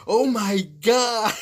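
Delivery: high, slow, drawn-out astonishment; retold shock. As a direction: stretch it out, aghast at what you witnessed. Retold shock at dog fight.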